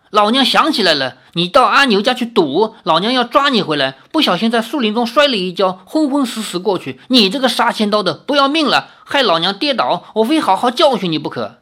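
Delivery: shrewish wife telling off her husband; angry scolding. Acted. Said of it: "喝道".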